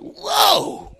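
put-on high startled whoa from a kid tasting grapefruit; child's whoa. Son reacts to grapefruit with a high whoa. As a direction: yelp it like a child hit by sourness. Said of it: "He was like".

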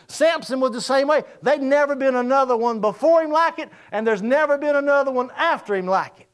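loud, high-pitched preaching climax; loud, high-pitched sermon climax, pressing the congregation; preaching at full force. Acted. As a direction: drive the message home to the church.

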